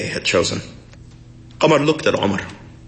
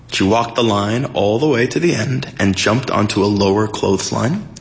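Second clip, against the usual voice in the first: ordinary delivery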